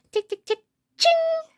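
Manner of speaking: high ticking then a ching; typewriter sound. A narrator's reading. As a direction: type it out, then ding the bell.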